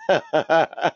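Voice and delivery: laugh. Real, not acted. Laughing at member Chris.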